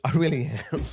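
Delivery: real laughter breaking through the words; laughing. Real, not acted. Vlogger laughing at his own urge to fight. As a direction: laugh it off, half amused at yourself.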